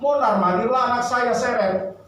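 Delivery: ordinary talk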